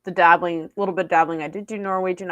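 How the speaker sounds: ordinary delivery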